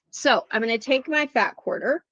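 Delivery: normal talking voice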